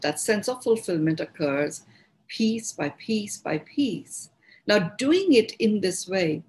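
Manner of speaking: ordinary delivery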